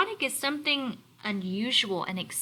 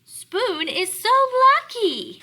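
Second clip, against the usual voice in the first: reading Fork's line; 12 semitones higher, 8 dB louder than usual. Acted. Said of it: "said Fork".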